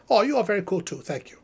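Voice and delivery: usual speaking voice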